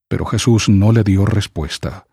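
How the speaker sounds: usual speaking voice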